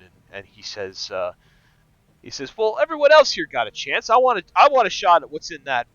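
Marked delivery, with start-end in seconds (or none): 2.6-5.9 higher, louder bar patron pressing his case; insistent patron. Acted. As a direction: demand a shot at the purse, keen.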